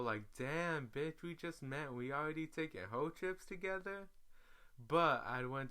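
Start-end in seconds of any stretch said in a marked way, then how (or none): 0.4-4.1 skeptical. Retold or imitated. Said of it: "Now I'm skeptical".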